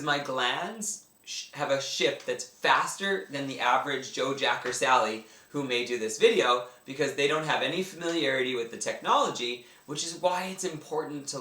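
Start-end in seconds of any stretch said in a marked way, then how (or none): none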